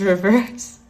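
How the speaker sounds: chuckling through the word; amused laugh on reverse; laughing at yet another reversed card. Real, not acted.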